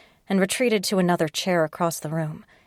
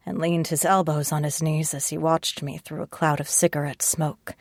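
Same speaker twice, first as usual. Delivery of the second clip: ordinary delivery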